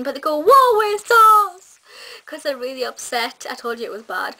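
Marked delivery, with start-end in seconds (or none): 0.4-1.8 high, loud, drawn-out self-pitying wail; poor otter impression. Vlogger mimicking the sad otters, hammed-up wail. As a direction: ham up a woeful little otter. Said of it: "it is a really poor impression".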